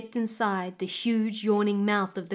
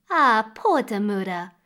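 loud, false sympathy; fake pity; 6 dB louder than usual. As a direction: pretend to feel sorry. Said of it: "lied the stepmother".

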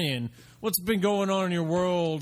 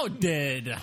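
ordinary delivery

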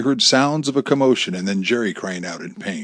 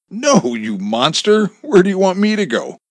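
loud outburst rising high; outraged defiance; 10 semitones higher, 6 dB louder than usual. A narrator's reading. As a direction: lash out at the captor. Wife lashes out at captor.

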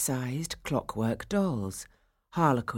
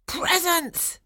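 very high-pitched squeal of glee; excited cry. A narrator's reading. Boys spotting gifts under the tree, excited cry. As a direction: cry out high like thrilled children. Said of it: "the boys cried".

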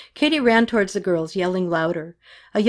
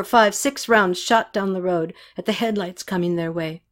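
usual speaking voice

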